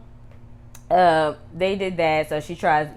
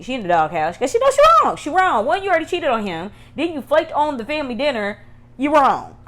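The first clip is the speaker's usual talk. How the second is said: much higher, louder and fast; heated scolding; 9 semitones higher, 6 dB louder than usual. Real, not acted. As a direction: call her out, fired up. Scolding Whitney for flaking.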